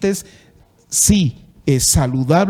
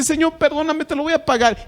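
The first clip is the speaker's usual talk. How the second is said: high-pitched, imitating a servant begging his master for mercy; "le soltó" voiced high, as a debtor begging; mock pleading debtor; 9 semitones higher than usual. Preacher retelling the parable, imitating the servant begging his lord.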